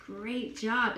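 usual speaking voice

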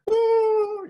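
imitating her panic in front of the crying students; mock panic squeak. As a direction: squeak in fake horror. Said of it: "And I'm like".

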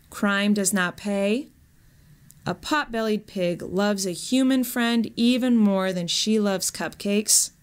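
ordinary delivery, nothing marked

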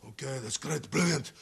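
normal talking voice

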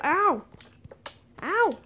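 sharp high ow; pained yelp. Real, not acted. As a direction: cry out in sudden pain. Being hit by mobs.

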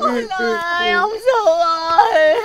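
crying out for husband, slow and broken; a widow weeping for her drowned husband; sobbing wail. Acted. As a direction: sob the name out. Widow wails, crying heard.

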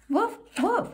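high playful yapping woofs; puppy bark. Acted. Reading to a child, puppy Biscuit barks high.

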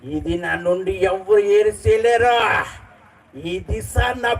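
mimicry of the Pushpa hero's boasting dialogue; Allu Arjun as Pushpa. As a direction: drag each line out with rustic swagger. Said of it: "ఇంకో voice చేస్తున్నాను".

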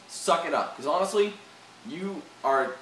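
ordinary talk

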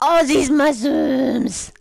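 gasp, then a slow loud "Oh, these mushrooms!"; excited discovery; delighted gasp. Real, not acted. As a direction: gasp at the find and stretch it out. Finds mushrooms in game.

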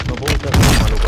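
loud exasperated complaint; frustrated outburst. Real, not acted. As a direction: vent your frustration loudly. Frustrated at weapon in game.

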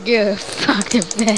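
drawn-out, deliberate command; slow stern order. Pretend. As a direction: spell out each word slowly. Ordering the son to bed.

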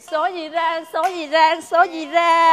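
loud chanting lô tô call; bingo caller. Acted. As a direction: belt out the numbers like a bingo caller. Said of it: "Kêu lô tô gì".

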